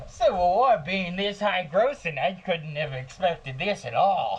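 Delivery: high, drawn-out mock executive voice; stunned studio CEO. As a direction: stretch it out slowly as an astonished studio boss. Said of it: "the C E O of, uh, Sony is at a cigar".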